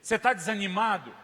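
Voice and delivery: loud, high, forceful preaching; fiery preaching. Acted. Pastor to congregation, loud fiery preaching.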